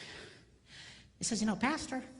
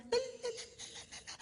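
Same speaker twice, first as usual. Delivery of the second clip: very high, faint babble; tiny high far-away babble; distant chatter; 13 semitones higher, 8 dB quieter, much faster than usual. Retold or imitated. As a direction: mimic chatter fading from the next room. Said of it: "she goes into the living room".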